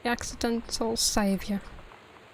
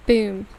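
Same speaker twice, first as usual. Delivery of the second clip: a loud spoken boom for the cannon; 8 dB louder than usual. A narrator's reading. Storyteller voicing the pirate ship's cannon shot, loud boom. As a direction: punch out the boom like cannon fire.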